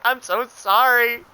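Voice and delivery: high, drawn-out retold apology; put-on sympathetic voice, slow and high; mock apologetic caller. Caller apologizes after the rant.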